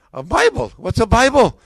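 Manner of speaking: high, loud, incredulous question; baffled New York lady. Retold or imitated. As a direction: echo it back as a puzzled saleslady. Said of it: "the lady says".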